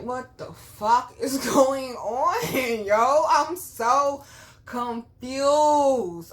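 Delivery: loud, high, drawn-out disbelief with a laugh; retold baffled cry, stretching the words out; bewildered outburst. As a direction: exclaim it loudly in exaggerated confusion. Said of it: "And I'm like".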